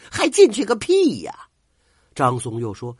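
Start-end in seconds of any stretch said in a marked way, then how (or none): 0.1-1.3 furious shout, much higher and louder. Acted. Lao Pi kicking his servant away, furious yell. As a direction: spit it out in a rage. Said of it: "怒气冲冲地说".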